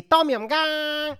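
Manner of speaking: involuntary shout of Pad Thai; sudden outburst. Acted. Repeats the outburst.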